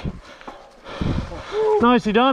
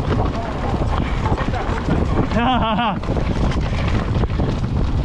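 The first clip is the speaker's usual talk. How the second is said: breathless laugh. Real, not acted. Laugh after a near miss.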